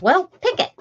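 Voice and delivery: high, bossy grandma. Retold or imitated. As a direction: answer like a blunt grandma. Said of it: "she'd be like".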